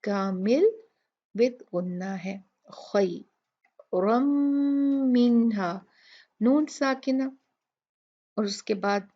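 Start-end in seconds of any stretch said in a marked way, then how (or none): 2.7-5.7 slow recited खैरम with a long nasal hum; nasal ghunna recitation. Shown as a demonstration. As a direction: stretch the humming nasal. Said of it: "बहुत अच्छे से गुन्ना करना है". (खैरम में लंबा गुन्ना)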